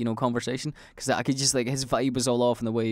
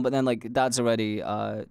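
ordinary talk

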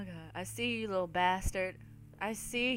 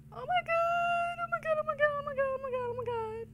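panicked; faster than usual. Real, not acted. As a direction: wail in fear over and over. Repeated high panic.

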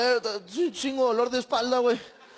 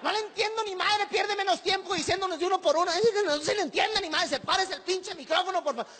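mock angry passenger; 8 semitones higher than usual. Passenger yelling at attendant.

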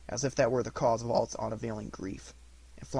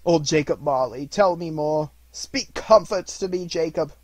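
raised, loud plea; imploring; 5 semitones higher, 10 dB louder than usual. A narrator's reading. Scrooge begs Marley's ghost for comfort, imploring. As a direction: beg for comfort, high and louder.

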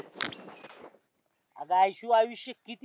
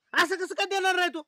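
चिडून विरोध; 6 semitones higher, 5 dB louder than usual. Acted. पैसे नाकारल्यावर विरोध.

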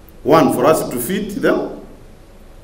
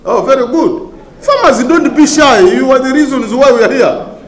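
louder, laughing as he calls out; laughing encouragement; 7 semitones higher, 6 dB louder than usual. Real, not acted. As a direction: laugh while urging the farmers on. Cheering on shy farmers.

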